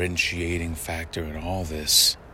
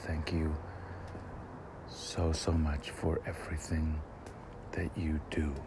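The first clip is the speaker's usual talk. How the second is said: tender; 8 dB quieter than usual. Retold or imitated. Retold tender thanks to sleeping wife.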